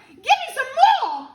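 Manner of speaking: high, loud demand; greedy cry. A narrator's reading. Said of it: "she cried".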